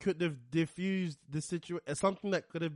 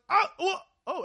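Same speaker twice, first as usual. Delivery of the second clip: mock outrage; 11 semitones higher than usual. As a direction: start to flare up.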